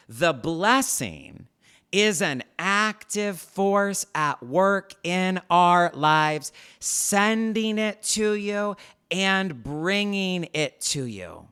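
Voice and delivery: slow dictation. Shown as a demonstration. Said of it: "Write this down or type this out".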